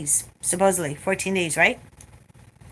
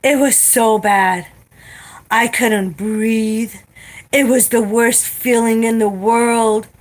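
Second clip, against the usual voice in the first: slow, whiny mock suffering; slow mock-dramatic coworker complaint; mocking over-the-top drama; 3 semitones higher, 4 dB louder, slower than usual. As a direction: ham up her exaggerated misery. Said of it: "over the top exaggerating".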